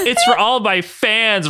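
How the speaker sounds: high-pitched, drawn-out proud cry. Acted. Ryan defends his vlogging to Logan, crying out. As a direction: cry it out, stretching "fans". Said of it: "Ryan cried out".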